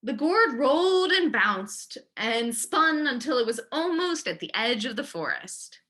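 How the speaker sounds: usual speaking voice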